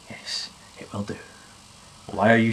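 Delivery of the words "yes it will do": hushed self-reply. Acted. Answering self in other voice.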